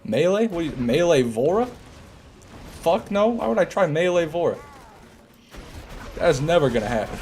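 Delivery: high, disbelieving squeak; incredulous. Real, not acted. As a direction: scoff at a ridiculous idea. Incredulous reaction to suggestion.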